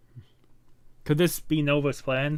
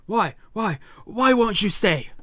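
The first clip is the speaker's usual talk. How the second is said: higher, louder desperate plea; pleading cry; 8 semitones higher, 4 dB louder than usual. Acted. Companion begs her hero to stay, pleading higher and louder. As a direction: beg him not to leave. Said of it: "He's crying".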